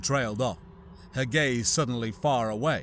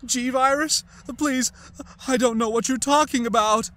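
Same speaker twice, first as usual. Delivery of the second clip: much higher, pleading denial; feigned panic; 11 semitones higher, 5 dB louder than usual. Pretend. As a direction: beg like you know nothing. Ada feigns ignorance, shaking.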